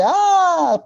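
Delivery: a high startled cry of "Ah"; girl frightened by grandma's mask screams "Ah" high; fright scream. Acted. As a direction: yelp it as if a monster jumped out. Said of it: "pega el grito".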